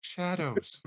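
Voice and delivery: high-pitched wisp voice; Wispy. Acted. Wisp answers what they look like, in a high creature voice.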